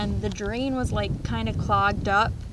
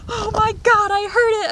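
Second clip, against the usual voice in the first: high, loud panicked shriek; a panicked yelp at a snapping tail; retold freak-out; 12 semitones higher, 8 dB louder than usual. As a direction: squeal it in horror. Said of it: "I'm, like, freaking out".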